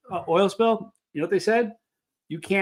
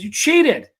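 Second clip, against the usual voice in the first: higher, louder mock protest of being cheated; imitating an outraged cry; 7 semitones higher, 7 dB louder than usual. Retelling a movie duel, mock outraged protest. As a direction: cry foul like a wronged swordsman. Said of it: "And he goes".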